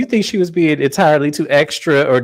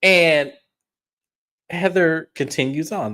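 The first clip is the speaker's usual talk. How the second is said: normal talking voice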